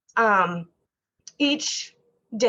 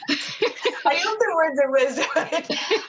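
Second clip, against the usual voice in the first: laughing through it, a bit higher and slower; 6 semitones higher, slower than usual. Real, not acted. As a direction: laugh off the compliment. Laughs at being called wise.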